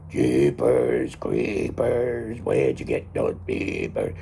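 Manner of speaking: very low, slow, gruff singing; gruff ghost voice. Retold or imitated. Imitating the voice on the haunted-house recording, gruff and low. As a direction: growl the song way down low, dragging it out. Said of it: "with a really gruff voice".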